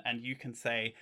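ordinary delivery

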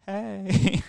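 high, breezy greeting; mock casual. Calling a friend about his girlfriend, mock casual hey. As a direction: sound casual asking a favor. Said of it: "And I was just like".

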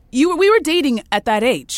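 loud, high insistence; emphatic. Real, not acted. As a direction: push it out with force. Emphatic reminder.

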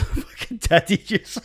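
high, loud words through real laughter; laughing. Real, not acted. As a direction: burst out laughing while saying it. Cracking up at own phrase.

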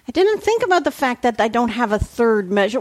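ordinary talk

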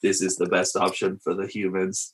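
low, soft robot monotone. Pretend. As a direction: drone it like a computer. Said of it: "I'm talking like a robot".

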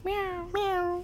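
high, soft little meows; kitten voice. Pretend. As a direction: mew like a tiny kitten. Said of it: "Do your best cat impression".

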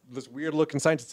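ordinary talk

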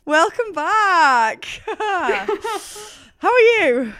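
high, stretched-out fake Australian accent; hammed-up Australian greeting, very drawn out. Welcoming listeners back, exaggerated bad Australian accent. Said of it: "horrific attempt at an Australian accent".

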